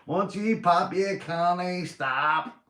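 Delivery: put-on high-pitched, loud Belfast accent. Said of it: "We Belfast accent there".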